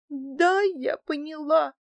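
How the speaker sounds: high, slow, tearful mumble; mumbling through tears, barely holding together; choking back tears. A narrator's reading. Housekeeper answering her angry boss, choking on tears. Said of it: "давясь слезами, промычала".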